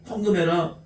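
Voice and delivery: ordinary talk, nothing marked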